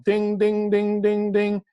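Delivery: high, steady dinging like a bell; imitated alarm bell. Warning listeners about ego-mind words, imitated bell. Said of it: "like a big flag and a big bell".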